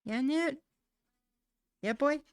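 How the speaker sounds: very high, loud pet call; calling the cat. Real, not acted. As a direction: sing out to your cat.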